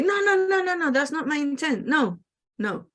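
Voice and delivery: quick, high-pitched "no, no" protest; hasty denial. Retold or imitated. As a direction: rush to deny any bad intent. People protesting they meant no harm.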